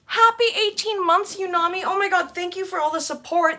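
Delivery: high-pitched gushing thanks; excited thanks. Real, not acted. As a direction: gush over a loyal supporter. Thanking member at eighteen months.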